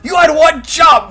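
high exasperated yell; angry shout. Real, not acted. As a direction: shout in frustration. Yelling at the character.

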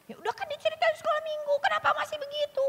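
very high-pitched mock exasperation; demanding parent. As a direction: act out an impatient parent's high, plaintive scolding. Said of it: "kita nuntut lagi".

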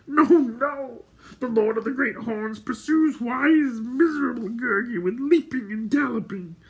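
high wailing creature voice; Gurgi's protest. A narrator's reading. Gurgi protesting to his mighty protectors, wailing. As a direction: plead high, ending on a loud cry.

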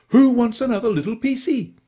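put-on high, loud cooing baby talk; doting baby talk. A narrator's reading. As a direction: gush over the little dog like a doting owner. Baby talk feeding the dog.

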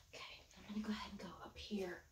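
hushed unvoiced whisper. Real, not acted. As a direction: whisper it to yourself. Sudden whisper while painting.

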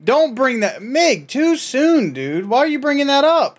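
high, drawn-out protesting yell; mock outrage. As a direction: overblown offended wailing at a friend. Mock outrage over 9/11 joke.

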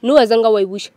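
loud, angry protest; angry confrontation. Real, not acted. Woman accusing ex of never repaying, angry and loud. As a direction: cut off the excuse at full volume. Confronting ex over unpaid debt.

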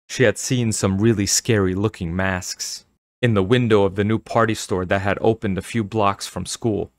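ordinary delivery

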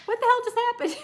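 high-pitched, amused disbelief; acted bewilderment. Coach voices client whose wish landed, bewildered. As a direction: imitate someone stunned by sudden success.

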